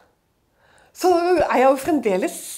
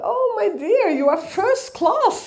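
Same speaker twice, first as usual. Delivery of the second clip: higher, slow, delighted English with a laugh; sweet stewardess; 10 semitones higher, 3 dB louder, slower than usual. Retold or imitated. Flight attendant telling her she's in first class, delighted. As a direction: sing it out kindly, laughing. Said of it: "Og hun liksom bare".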